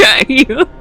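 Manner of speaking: ordinary talk, nothing marked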